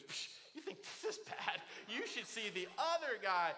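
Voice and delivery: mock-cocky kid voice, breathy scoff at the start; kid nailing the joke. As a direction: imitate the boy proudly delivering the line. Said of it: "he nails the joke".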